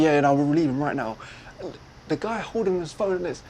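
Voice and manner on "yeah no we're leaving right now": loud, hurried backtracking; sudden switch. Retold or imitated. Caught-out driver telling passenger they're leaving, loud and abrupt. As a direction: imitate a driver suddenly changing his story. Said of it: "he changes instantly".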